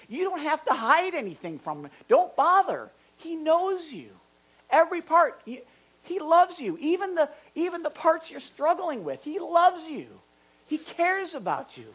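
high-pitched, fervent assurance; impassioned preaching. Real, not acted. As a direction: preach it with urgent conviction, pitch held high. Preaches God's love with fervour.

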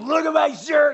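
put-on high drunken shout; hammered guy. Drunk man spilling beer, shouting rowdily. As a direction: holler it like a sloppy drunk. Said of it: "this guy just completely hammers".

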